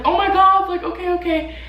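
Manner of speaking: jolted awake and told to push; panicked gasp. Retold or imitated.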